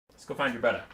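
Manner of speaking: ordinary talk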